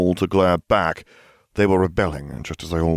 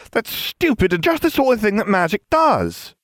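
put-on much higher girl's character voice; excited cry. A narrator's reading. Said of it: "Ginevra cried".

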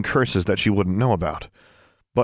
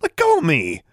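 struggling protest; 7 semitones higher, 3 dB louder than usual. A narrator's reading. Struggling in his grip.

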